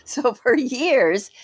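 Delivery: usual speaking voice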